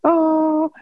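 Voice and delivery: high, sustained single-note chime; imitated MacBook chime. Podcaster showing the MacBook turn-on sound, imitated chime. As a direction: hold one high "oh" like a startup tone. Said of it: "that sound a MacBook makes".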